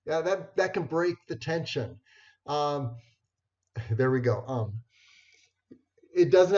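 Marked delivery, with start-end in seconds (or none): none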